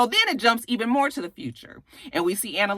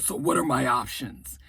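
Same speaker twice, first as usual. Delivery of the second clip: dopey Nate; 8 semitones lower, 5 dB quieter than usual. Retold or imitated. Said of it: "Real Nice Nate was like".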